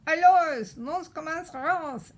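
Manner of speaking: high, ringing call to begin the lesson; tutor's loud French. A narrator's reading. Tutor opening Eloise's French lesson, loud and high. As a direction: launch the French loudly, pitched well up. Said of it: "what makes Philip angry".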